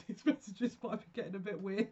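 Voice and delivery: breaking into laughter mid-sentence; amused laughing. Real, not acted. Channeler warning viewers that messages may get weird, laughing. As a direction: talk on as the giggles take over.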